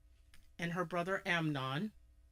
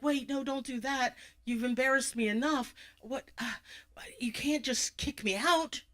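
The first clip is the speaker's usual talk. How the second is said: pleading; 6 semitones higher than usual. Acted. Tammy begs not to be thrown out.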